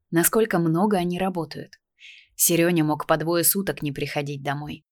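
usual speaking voice

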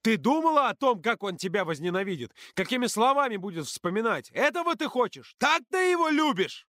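high, hysterical shouting; furious high-pitched tirade; yelling at full voice. A narrator's reading. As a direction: scream the questions on the edge of hysteria. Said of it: "заорал в голос".

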